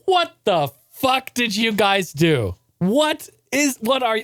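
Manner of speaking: high, slow, stunned parent voice; shocked parents. Retold or imitated. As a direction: play the stunned parents seeing the old car. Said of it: "My parents were like".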